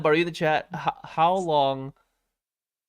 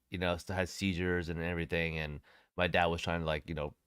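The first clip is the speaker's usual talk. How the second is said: subdued sadness; 6 semitones lower, 9 dB quieter than usual. Real, not acted. Sad memory of dog.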